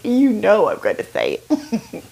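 put-on southern-accented teasing with a laugh; sweet southern accent. Friend warning she will tell, sweet southern accent. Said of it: "in her sweet southern accent".

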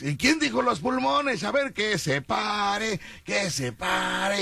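high, slow calling host; hyped fairground barker. Retold or imitated. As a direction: call out slowly to the crowd.

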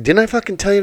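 high pitched angry scold; angry cop. Retold or imitated. Cop scolding the owner who came back, in a high angry voice. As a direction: snap at him like a fed-up cop.

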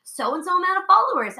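high, mock-impressed announcement; imitated bragging on someone. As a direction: mimic a colleague showing her off. Retells teacher boasting about her.

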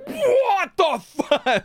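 long, high, loud howl; retold bellow. Patient reliving his hand being broken back, hammed-up bellow. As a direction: re-enact a drawn-out bellow of pain. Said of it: "I bellowed".

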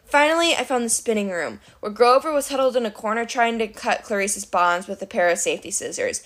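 ordinary delivery, nothing marked

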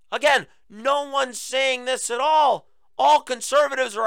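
exasperated shout. Real, not acted. Exasperated outburst.

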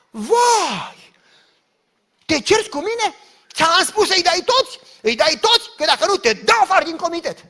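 loud, high outraged shouting; furious outburst. Retold or imitated. Woman screaming at the treasurer over the church money. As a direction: scream at him like a tyrant.

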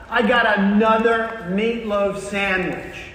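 slow, stretched mock whine; exasperated complaint. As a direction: drag out the misery of the same lunch. Worker complains about lunch, acted.

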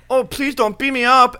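high, slow imitated plea; hammed-up high pleading; mock pleading. Acting out the confronted man pleading.

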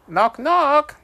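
put-on very high, loud kid voice; Wolfie's voice. A narrator's reading. Wolfie starting a knock-knock joke at the door, very high and gleeful. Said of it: "Wolfie said".

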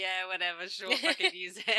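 teasing imitation of an offhand friend, through laughter; mock dismissive. Teasing a friend about her offhand reply, mock dismissive. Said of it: "That's just how I speak".

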